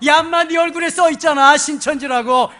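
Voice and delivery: loud, high, scornful telling-off; re-enacted shout at an unmasked intruder; scolding retort. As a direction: throw it back at them forcefully. Tells off the exposed visitor.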